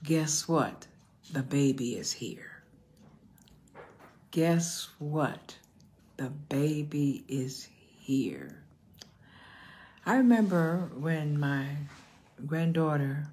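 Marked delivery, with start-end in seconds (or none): 4.3-8.6 slow emphatic repeat. Real, not acted. Preacher repeats the sermon topic to the congregation, slowly and emphatically.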